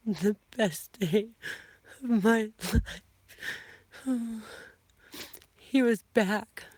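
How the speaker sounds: high, slow and teary, close to crying; words catching as emotion wells up; tearful, voice breaking. Real, not acted. Telling viewers about the best day of her life, tearful and high.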